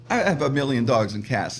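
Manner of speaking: ordinary talk, nothing marked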